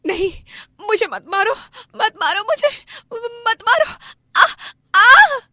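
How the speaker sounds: high, loud sobbed pleas; screaming in pain. Acted. Beaten wife begs him to stop, screaming and sobbing. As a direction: plead in shrieks and cries. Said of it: "दर्द से जोरों जोरों से चीखती चिल्लाती और बिलखती".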